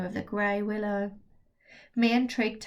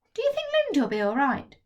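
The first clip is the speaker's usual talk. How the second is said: put-on higher, quick worried boy's voice; worried young boy rattling off questions; anxious kid Trig; 5 semitones higher, 4 dB louder, faster than usual. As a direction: fret it out fast like a nervous boy. Trig's anxious child voice.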